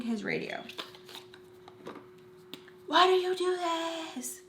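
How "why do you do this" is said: high, drawn-out imitation of screaming; mock top-of-the-lungs wail, held back in volume; retold scream. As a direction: imitate him screaming, stretching every word. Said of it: "Screaming on the top of his lungs".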